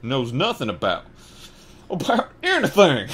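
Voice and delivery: loud rising outraged growl; building fury; growling outrage. A narrator's reading. Hagrid growling at the Dursleys, outraged and loud. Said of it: "he growled at the Dursleys".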